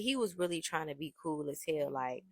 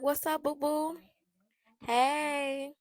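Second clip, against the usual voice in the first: high, sing-song mock sweetness; taunting greeting; 10 semitones higher, much slower than usual. Pulling up on the rival, high sugary taunt. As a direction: sweetly taunt the girl you're about to humiliate. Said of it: "I'm just taunting this bitch".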